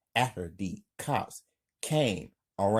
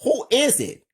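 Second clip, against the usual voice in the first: high, loud shouted question; high-pitched yell; 10 dB louder than usual. A narrator's reading. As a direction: call out loudly to the door. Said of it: "Amber yells".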